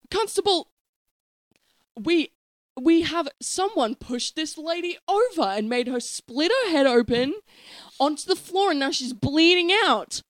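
high, loud furious yelling; angry shouting. Acted. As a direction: yell at the useless constable. Grandmother yelling at police officer.

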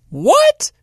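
high-pitched, shocked outburst; incredulous. Retold or imitated. Boy reacting to his friend having no plans, incredulous. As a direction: squawk it in exaggerated disbelief, way up high. Acted disbelief at friend's answer.

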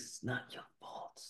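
breathy whisper, barely voiced. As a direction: lean in and breathe it. Repeated line, now whispered.

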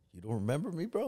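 lightly voiced gangster; tough homeboy. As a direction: press him like an old rival. Old homeboy confronting him.

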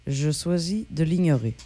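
slow, low, deliberately dismissive; deadpan brush-off. Retold or imitated. Co-host quotes her on-air brush-off, slow and deadpan. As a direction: coolly declare you'll ignore it. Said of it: "je dis toujours".

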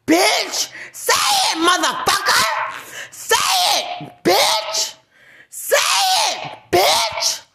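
high screamed taunts in short bursts; furious goading. Real, not acted. Daring an opponent to say it, screaming furiously. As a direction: scream the dare over and over.

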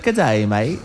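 unsure go at an Aussie accent; Australian accent. Shown as a demonstration. Guest has another go for the host, unsure Australian accent. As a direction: attempt Australian, with a questioning lift. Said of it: "how would I do it?".

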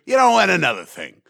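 grouse through a stand-up bit, stretching out the words; comedian's exaggerated rant voice; George Carlin. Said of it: "where George Carlin could".

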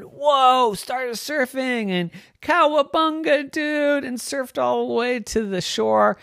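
high, bright cheer; storyteller celebrating the ride; thrilled surfing. A narrator's reading. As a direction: ride the wave with glee. Surfing triumph.

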